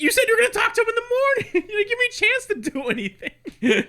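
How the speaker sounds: very high, very fast, laughing; rapid mock complaint. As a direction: rattle it off in a shrill rush of blame. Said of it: "I was like".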